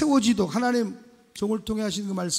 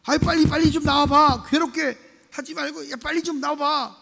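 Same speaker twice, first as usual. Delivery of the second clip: pleading friend; 7 semitones higher, 6 dB louder than usual. Acted.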